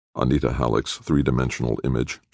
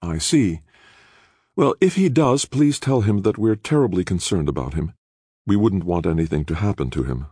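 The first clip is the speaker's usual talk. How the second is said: lightly voiced chairwoman; 6 semitones higher than usual. A narrator's reading. Halleck voiced, veiled threat.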